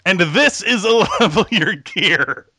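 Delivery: stumbling through the show name while still laughing; barely holding back giggles, rushing it; after laughing hard. Real, not acted. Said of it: "Fuck, let's try that again".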